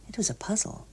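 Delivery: breathy hushed aside; unvoiced whisper. A narrator's reading. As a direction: whisper it, puzzled. Narrator whispers puzzlement.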